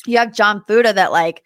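ordinary talk

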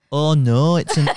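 loud Scouse pundit impression; Carragher. Shown as a demonstration. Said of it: "you do a Carragher impression".

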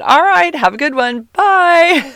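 high, bright-toned mock-cheerful farewell; mock cheery goodbye. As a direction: wave him off with fake cheer. Brushing him off as he leaves.

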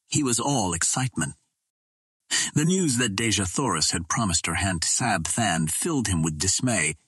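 ordinary delivery

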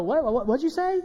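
high-pitched, playful pretend double take; mock disbelief. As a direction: act as if eager to hear it repeated. Imagined Jesus fishing for repeated praise.

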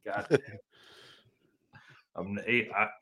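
ordinary talk, nothing marked

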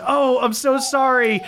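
apologetic Jaina. Acted. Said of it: "And Jaina goes".

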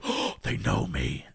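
low, slow amazed whisper; awed gasp. Retold or imitated. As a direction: breathe it in wonder.

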